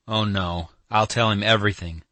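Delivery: plain reading voice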